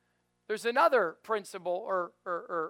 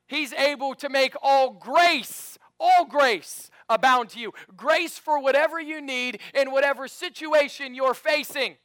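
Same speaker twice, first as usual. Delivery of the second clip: preaching at full force; 6 semitones higher, 9 dB louder than usual. Acted. Pastor preaching grace at full force to the church. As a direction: drive home the promise of grace.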